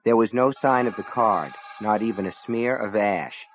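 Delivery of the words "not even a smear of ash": ordinary delivery, nothing marked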